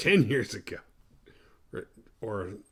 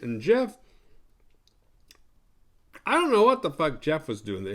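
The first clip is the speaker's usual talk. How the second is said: normal talking voice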